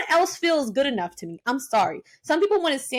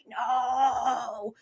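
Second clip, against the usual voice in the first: retold dismay; 4 dB quieter than usual. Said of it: "I was like".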